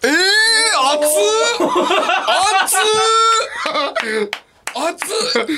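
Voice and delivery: high excited yelps; excited shout. Real, not acted. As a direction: squeal it high, teasing. Reacting to a romantic reply.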